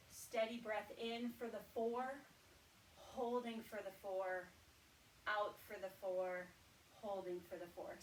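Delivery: walk them through each side of the square; guided breathing. Shown as a demonstration. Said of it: "So we'll work through that".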